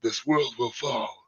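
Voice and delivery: soft, hushed villain impression; Steppenwolf. Host showing how the villain first sounded, soft impression. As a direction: imitate the villain's original voice, kept quiet. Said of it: "they changed his voice so much".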